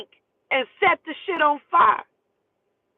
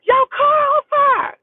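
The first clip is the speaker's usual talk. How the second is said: drawn-out, high-pitched scream imitating her; 8 dB louder, much slower than usual. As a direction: scream the alarm down the street. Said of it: "She's screaming".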